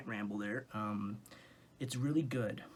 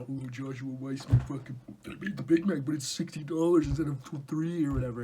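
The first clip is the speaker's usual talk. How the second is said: mocking critic; 3 semitones higher, 8 dB louder than usual. Vlogger mocking a viewer who praises homemade, loud sneer. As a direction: mimic an annoying foodie commenter. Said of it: "you know".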